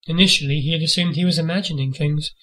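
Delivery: ordinary delivery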